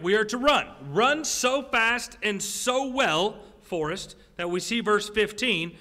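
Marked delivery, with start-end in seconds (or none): 0.0-3.4 much higher, stretched slowly, every word leaned on; emphatic urging. Acted. Preacher urging listeners to flee, slow and emphatic. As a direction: drag it out to drive the point home.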